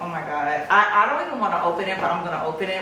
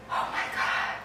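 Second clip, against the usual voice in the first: whispered, breath-only amazement; awed whisper; 9 dB quieter than usual. Real, not acted. Buyer seeing inside the bag, awed whisper. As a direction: whisper it, overwhelmed.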